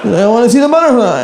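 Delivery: put-on high-pitched, childlike befuddled president; Biden impression. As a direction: plead like a confused old leader. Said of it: "just stare at a butterfly for".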